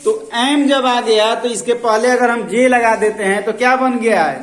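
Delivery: teacher projecting loudly to a noisy class; calling out loud. Real, not acted. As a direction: raise the voice over the room.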